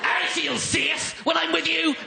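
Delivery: high, slow shouted Northern Irish affection; hammed-up frightening Northern Irish wife; scary Northern Irish accent. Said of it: "being loving with that accent".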